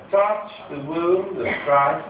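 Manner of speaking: slow, lightly plummy public school English; public school posh. Retold or imitated. Christ offering his wound, slow light public school posh. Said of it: "from quite a public school".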